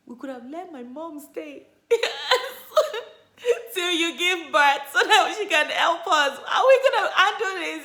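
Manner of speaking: high, loud, laughing while freaking out; laughing panic. Retold or imitated. Husband freaking out about twins, laughing loudly. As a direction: laugh through the alarm. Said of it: "He was all freaking out".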